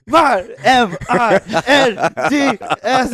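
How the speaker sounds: high, loud, slow, laughing through it; hammed-up wrong spelling through laughter; laughing mock spelling. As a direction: spell it badly while cracking up. Slim spelling wrong while hit.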